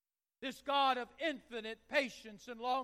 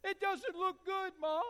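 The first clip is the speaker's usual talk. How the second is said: put-on upset little boy; 4 semitones higher, 4 dB louder than usual. Her little crying boy.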